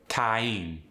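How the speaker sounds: "time" stretched into two sounds, ah-ee; exaggerated diphthong. Shown as a demonstration. Exaggerating to prove a point, two-sound glide in "time". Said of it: "I'm slightly exaggerating to prove a point".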